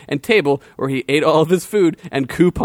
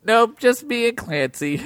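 put-on higher, eager little boy voice; sweet boy; 8 semitones higher than usual. Gregory welcoming the warrior to tea, eager childlike voice. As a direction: gush like an innocent child.

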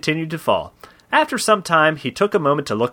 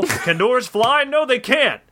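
high mock riddle, slow at first; hammed-up taunt, pitched up; taunting hero; 8 semitones higher, slower than usual. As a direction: pose a mocking question, then answer it triumphantly. Hero taunts before throwing door.

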